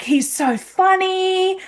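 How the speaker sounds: high, slow gushing imitation; smitten woman. Woman defending the drunk guy she likes, gushing. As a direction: draw it out high, gushing about him.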